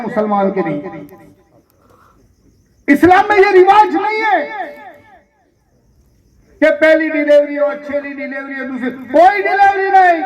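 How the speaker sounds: high, emphatic pulpit denunciation; fiery sermon rebuke; forceful preaching. Acted. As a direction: cry out against the injustice. Preaches forcefully against the custom.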